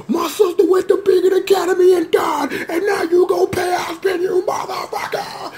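high, yelling hammed-up rage; mock furious Hazel. Viewer acting out Hazel's rage at the fight, high mock yell. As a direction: shout it like a grieving brawler. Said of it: "Hazel like".

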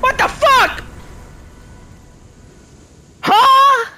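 high, loud drawn-out yells; outraged disbelief. Real, not acted. Gamer robbed of the win, outraged high yelling. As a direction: yell each question in incredulous fury. Stunned fury after death.

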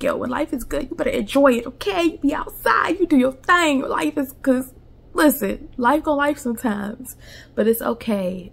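high, upbeat pep talk; hyped pep talk. Retold or imitated. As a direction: pump them up, big sister energy. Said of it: "my videos where I'm like".

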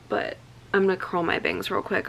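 ordinary talk, nothing marked

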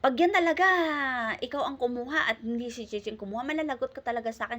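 a mother warning her son he's in trouble; mock stern warning; retold threat. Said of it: "sabi ni madam nun".

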